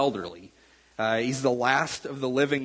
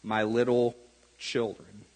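tender; 4 semitones lower than usual. Retold or imitated. Elder John writing to his church, slow and tender. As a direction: linger on "little" with affection. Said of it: "he shows us the heart of God".

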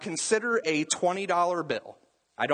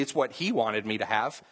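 usual speaking voice

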